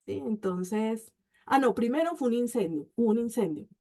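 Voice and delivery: ordinary talk, nothing marked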